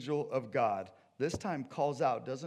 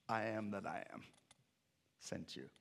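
low, soft divine declaration; hushed, solemn God; 3 semitones lower, 7 dB quieter than usual. Acted. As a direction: lower the voice and let the name fall softly. God gives Moses his name.

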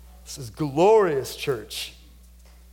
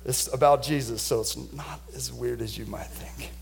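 whispered aside; 6 semitones lower, 6 dB quieter than usual. Real, not acted. Aside to congregation.

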